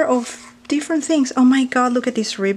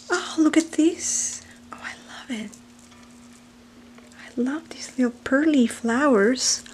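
hushed breathy whisper of wonder; awed whisper; 3 semitones higher, 3 dB quieter than usual. Real, not acted. Unboxing pretty flowers, she whispers in awe. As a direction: whisper as if afraid to break it.

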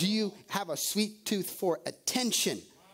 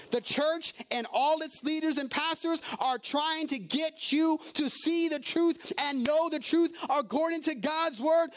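fervent preaching; 6 semitones higher than usual. Acted. Preacher urging the congregation to know the truth, fervent. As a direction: build the truth with weight.

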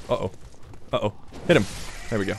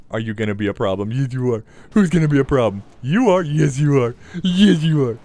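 put-on baby talk to a dog; 3 dB louder than usual.

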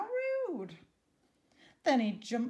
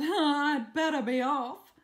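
loud put-on character voice; fleeing Reginald; 3 semitones higher, 9 dB louder than usual. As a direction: blurt out a loud excuse to leave. Reginald hurriedly flees the fire.

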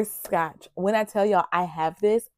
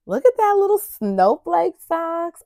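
excited; 10 semitones higher, 4 dB louder than usual. Real, not acted. Excited over gift socks.

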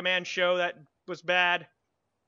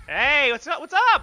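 mock greeting; 10 semitones higher, 11 dB louder, much slower than usual. Real, not acted.

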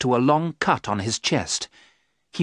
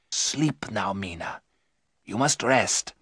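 gentle soothing; 4 dB quieter, slower than usual. Acted. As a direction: hush her to sleep. Van Helsing soothes Mina.

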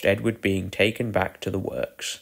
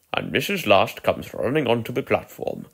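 usual speaking voice

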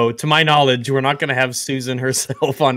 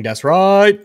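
mock Hebrew Israelite; 7 semitones higher, 6 dB louder than usual. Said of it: "they were quoted as saying".

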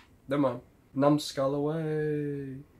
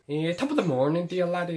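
higher, singsong mock Irish; bad Irish accent; 5 semitones higher, 3 dB louder than usual. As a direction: have a second go at a badly faked Irish lilt. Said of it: "Can't really do an Irish accent".

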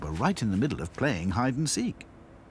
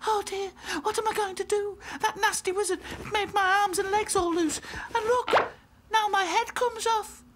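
sobbing; 3 dB louder than usual. A narrator's reading. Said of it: "sobbed Lucy".

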